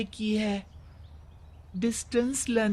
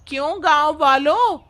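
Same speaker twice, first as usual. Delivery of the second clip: louder call out to the whole village; rallying the crowd; 4 semitones higher, 6 dB louder than usual. Acted. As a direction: win the villagers over with a raised voice.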